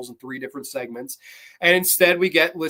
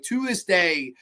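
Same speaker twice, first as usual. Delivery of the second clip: normal talking voice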